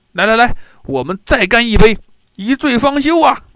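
rowdy call to down another cup; rowdy drunk toast. Acted. Drunk general pressing his host to drink, rowdy and loud.

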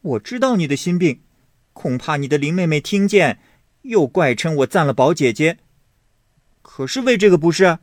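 put-on high, quick, playful girl's voice; lightly voiced young lady teasing. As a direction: tease him brightly. Xiangyun teases Baoyu.

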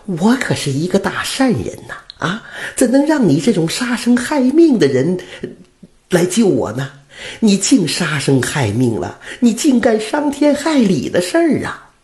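put-on righteous old woman scolding; angry. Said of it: "老太太生气地说". (老太太生气地斥责屠夫)

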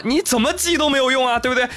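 ordinary talk, nothing marked

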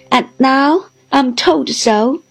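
a mild mock whimper, dragged out slowly; self-pitying old widow, slow and plaintive; pitiable whimper. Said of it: "with a pitiable whimper".

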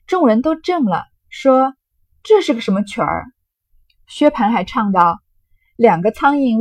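loud, lively storytelling voice acting out a drinking game; dramatic novel reading. Teacher reads the drinking-game scene aloud, loud and lively. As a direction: read the scene with relish, loud and animated.

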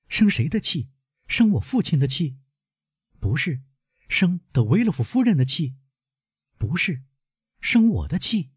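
put-on high-pitched young woman questioning her grandfather; concerned girl, pitched well up; worried granddaughter. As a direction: lift the voice high, anxious questions.

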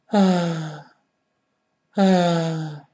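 long sighed "ah"; demonstrated sigh. Shown as a demonstration. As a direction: let out a drawn-out, falling sigh. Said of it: "就像人在叹气".

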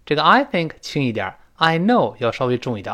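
normal talking voice

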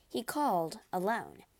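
usual speaking voice

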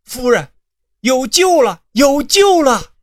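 high, loud, slowing cry of relief; sudden shout. Acted. Old advisor shouting the news to the lady, loud and high. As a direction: shout it out as if salvation came. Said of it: "突然喊道".

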